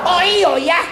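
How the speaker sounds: put-on high, loud Manado-accented reply. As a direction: say it the Minsel way. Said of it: "kasih orang minsel". (logat Manado yang tinggi dan keras)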